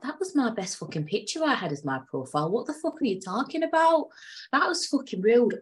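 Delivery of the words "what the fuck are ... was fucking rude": much higher, outraged disbelief; indignant outrage. Real, not acted. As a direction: scoff at the insult in disbelief. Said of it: "I went on a little rant".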